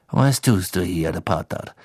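low-voiced stern professor; low, menacing challenge to a pupil; Snape's cold disdain. Snape confronting Harry at the hidden stairs, cold and low.

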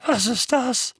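hoarse and high. Acted. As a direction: croak it out hoarsely. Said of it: "sagte Onkel Vernon heiser".

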